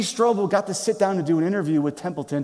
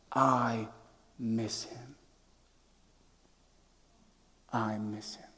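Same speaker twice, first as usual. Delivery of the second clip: soft, broken, near-tearful murmur; hushed tearful confession; 7 semitones lower, 9 dB quieter, slower than usual. Acted. Old atheist confessing to Strobel, hushed and tearful. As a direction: let the words barely come out, choked up. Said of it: "tears flooded his eyes".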